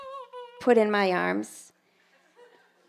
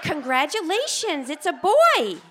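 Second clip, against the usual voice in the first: higher, slower, bright with a laugh; cheery announcement; 6 semitones higher, slower than usual. Retold or imitated. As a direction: announce the baby, pitched up and beaming. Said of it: "She said".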